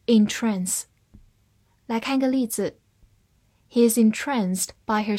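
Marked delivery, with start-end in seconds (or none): 0.1-0.8 American "entrance", verb, stressed on the second syllable with a short a; American pronunciation. Shown as a demonstration. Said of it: "美式发音读作".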